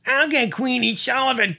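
high, slurred toothless shout; Louis, toothless boast. Retold or imitated. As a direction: imitate a wasted drunk yelling a tough threat. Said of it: "before he got the last syllable of Queenie Sullivan out".

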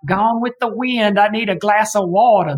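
mock a terrible fake Alabama accent; bad Alabama accent. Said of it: "Alabama accents always just end up sounding like".

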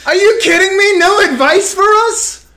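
outraged disbelief. Real, not acted. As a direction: cry out in mock betrayal. Hosts protest no advice.

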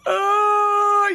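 high, loud dramatic wail; mock wailing. Woman acting out her own emotional wreck, mock wail. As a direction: wail like tears are storming. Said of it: "Tears are storming".

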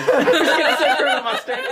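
high, loud, through laughter; real giggling fit; cracking up. Real, not acted. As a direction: laugh helplessly while admitting it. Cracks up at own slip.

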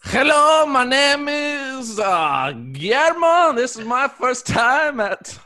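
a silly put-on French accent; mock French accent. Newcomer greeting the support group in hammed-up French accent.

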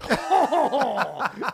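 high, hammed-up belly laugh; clowning in class with a hammed-up laugh; big mock laugh. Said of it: "I did this big laugh".

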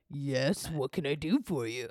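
a hint of a deep robot man; deep male Siri. Dad's male Siri answering, a mild deep voice. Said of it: "Deep-ass voice".

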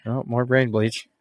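usual speaking voice

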